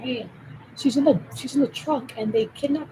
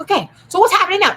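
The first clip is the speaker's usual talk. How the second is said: fast, high, louder question; eager; 9 semitones higher, 10 dB louder, faster than usual. Retold or imitated. Impatient viewer wanting the news now, eager. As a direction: rush it out eagerly. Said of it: "It's like".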